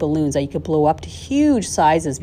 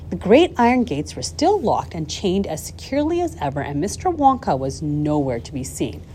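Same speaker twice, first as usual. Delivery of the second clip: ordinary delivery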